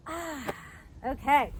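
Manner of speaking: usual speaking voice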